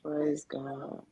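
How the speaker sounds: low, whispered amen-like response; hushed praise. Real, not acted. Listener responds to a survivor's story, whispering. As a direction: breathe it out under the voice.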